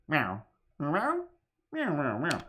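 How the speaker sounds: playful cat meows. Pretend. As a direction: meow like a cat.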